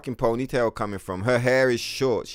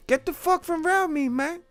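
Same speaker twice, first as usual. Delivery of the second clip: very high, squeaky rapper impression; exaggerated high celebrity impression. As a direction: do a first rough take at a pitched-up rapper voice. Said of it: "What does [name] say?".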